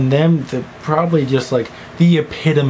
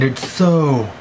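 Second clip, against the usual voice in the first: ordinary talk, nothing marked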